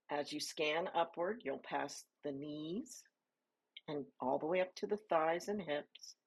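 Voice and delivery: normal talking voice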